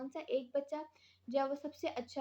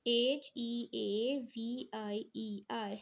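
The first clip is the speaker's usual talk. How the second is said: slow letter-by-letter dictation; spelling out; much slower than usual. Shown as a demonstration. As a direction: spell it slowly, each letter separate. Teacher spelling out a word.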